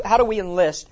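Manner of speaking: ordinary delivery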